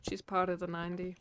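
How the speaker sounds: soft slip into a Southern accent. Real, not acted. Co-host answers about who counts as Southern, Southern accent slip. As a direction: let a Southern accent creep in quietly. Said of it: "slipped into my accent right there".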